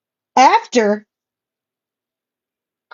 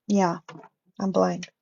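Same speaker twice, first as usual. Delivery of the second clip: quiet aside; 4 semitones lower, 10 dB quieter than usual. Real, not acted. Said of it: "glasses that I should be wearing".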